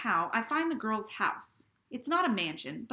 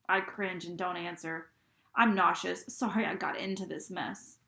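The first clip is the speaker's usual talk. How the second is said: plain reading voice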